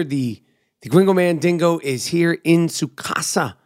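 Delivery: usual speaking voice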